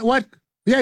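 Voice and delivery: usual speaking voice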